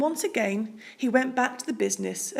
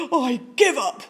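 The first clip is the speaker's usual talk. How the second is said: louder, mock-blubbering despair; 4 semitones higher, 7 dB louder than usual. A narrator's reading. Bear blubbers to the mouse, mild mock sob. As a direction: wail in mock defeat. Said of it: "he blubbered".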